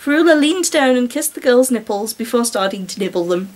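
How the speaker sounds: usual speaking voice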